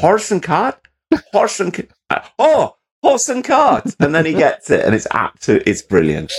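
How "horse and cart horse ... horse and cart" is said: Zach Bagans finally getting it. Retold or imitated. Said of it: "And he's going".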